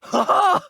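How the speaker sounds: high, loud burst of laughter; exultant laugh. Acted. Game hero thrilled by the action, exultant laugh. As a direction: whoop with exhilaration.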